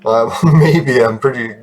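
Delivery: usual speaking voice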